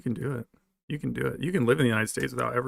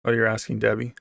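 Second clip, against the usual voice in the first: normal talking voice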